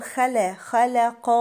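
"خلق" with heavy خ and ق, light "la"; thin middle lam. Shown as a demonstration. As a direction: keep ل thin between the heavy letters of "خلق". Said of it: "واللام اللي في النص مرققة".

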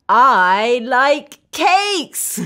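slow, drawn-out happy declaration; slow and emphatic for kids to repeat; enthusiastic liking. Shown as a demonstration. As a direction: declare it with relish, word by word. Modelling liking cakes for children.

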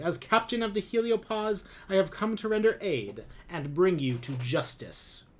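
a smug officer proclaiming his mission; pompous commander. A narrator's reading. Lum continues his announcement.

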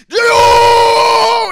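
long, high, drawn-out scream; imitating a furious, stretched-out battle cry; losing it. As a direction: bellow the name in hammed-up rage. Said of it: "JoJo fucking loses it".

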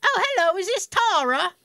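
high-pitched, bad British accent; hammed-up British woman on the phone; horrible British accent. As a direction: put on a dreadful British phone-lady voice. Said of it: "I do a horrible British accent".

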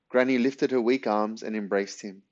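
ordinary delivery

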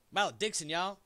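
loud Texan twang on Malediction, y'all; Southern accent. Acted. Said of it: "Southern slash Texas accent".

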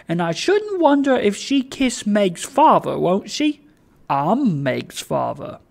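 playful, swooping fatherly voice; Trotty, coaxing the child. Acted. Old man to shy child, playfully coaxing.

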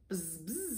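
two soft bee buzzes; soft storybook bee noise. A narrator's reading. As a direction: buzz quietly like a bee. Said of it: "says Bee".

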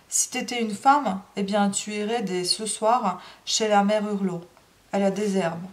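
ordinary delivery, nothing marked

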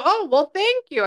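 high, lilting thanks; pleasantly surprised. Retold or imitated. Medium retelling her reply about the horses, pleasantly surprised.

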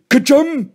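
high, loud play-acted sneeze; mock sneeze. A narrator's reading. Joe pretending to be Billy on peppered worm, mock sneeze. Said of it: "Joe sneezing".

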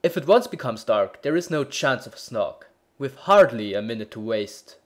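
a hint of an urgent captain, loud; lightly voiced hurried bellman; bellman in haste. A narrator's reading. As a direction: press him on loudly.

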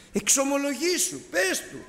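high, insistent coaxing; father urging the son, high and insistent. Retold or imitated. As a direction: press the child to call out and confess.

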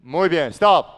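loud stop call; shouted stop. Real, not acted. Coach stopping the drill, shouting stop. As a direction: cut the play off with a loud shout.

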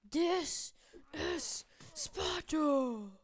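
long-drawn, high, booming shout; mock-epic war cry, loud and very slow; battle cry. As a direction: roar it out, stretching every word.

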